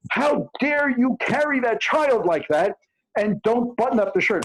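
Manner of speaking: put-on high, scolding old-lady cry; mock yell. Old lady on the bus scolding a young mother, high mock yell. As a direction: pitch it way up and scold like a fussy grandmother. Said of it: "yells at this slim lady and says".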